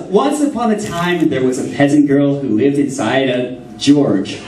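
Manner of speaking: slow fairy-tale reading voice; storybook narration. Acted. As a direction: tell it like a bedtime story, unhurried. Reads the made-up fairy tale aloud.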